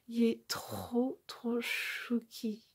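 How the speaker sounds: soft, slow, melting "trop chouki"; hushed and drawn out, melting with cuteness. Real, not acted. Melting over the little rabbit.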